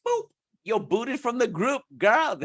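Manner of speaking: high, sassy sing-song; sassy dismissal. Acted. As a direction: flick them away, sassy. Booting a commenter.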